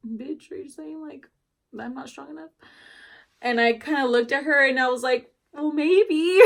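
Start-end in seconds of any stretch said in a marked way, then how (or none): 0.1-2.5 softer, higher sassy inner thought. Retold or imitated. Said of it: "And in my head". 5.5-6.4 higher, louder cheeky comeback; playful mock-cheeky answer; sassy retort. Sassy reply to doctor.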